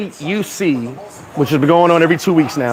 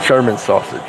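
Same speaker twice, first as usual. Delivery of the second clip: laughing through it; 8 semitones lower than usual. Real, not acted. Says joke again while laughing.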